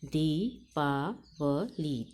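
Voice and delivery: दीपावली said slowly, syllable by syllable, stretching each long ई; drawn-out syllables. Shown as a demonstration. Teacher sounding out दीपावली for children, slow and stretched. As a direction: spell out दीपावली slowly for the class. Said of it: "द पर बड़ी की मात्रा है और ल".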